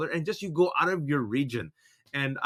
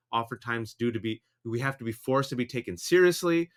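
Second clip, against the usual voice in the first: ordinary talk, nothing marked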